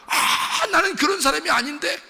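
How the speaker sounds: high, loud cry of anguished protest; gasp, then wail it out in self-reproach; anguished Judas. Acted. Judas unable to bear himself after the betrayal, anguished. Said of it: "자기를 견딜 수가 없는 거야".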